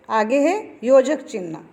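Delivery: usual speaking voice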